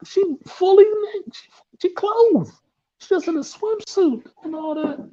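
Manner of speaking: swooning over an actress, mock delight; giddy high gushing. First seeing Salma Hayek on screen, high giddy gushing. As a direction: squeal it high and slow, laughing, smitten. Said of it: "and I was like".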